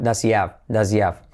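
"Does he have" linked as "das-ee-av"; dropped H. Shown as a demonstration. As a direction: skip the H in both "he" and "have". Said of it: "Saltamos la H de have".

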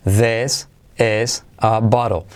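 very slow "this is a bottle", each word separate; slow word-by-word model. Shown as a demonstration. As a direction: spell out "this is a bottle" slowly, bottle as "baro". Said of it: "La T como R suave". ("this is a bottle" despacio, palabra por palabra)

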